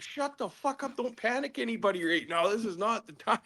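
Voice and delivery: mock stern scolding. Teller scolding the bleeding man not to cause panic, mock stern. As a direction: bark him into silence, imitating yourself. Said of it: "I'm like".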